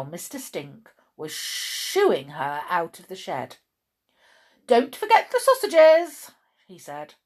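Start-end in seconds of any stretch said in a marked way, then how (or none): 4.7-6.3 loud, high parting order; calling after her. A narrator's reading. Mister Stink shooing Chloe out of the shed, calling loudly. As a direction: call it out emphatically.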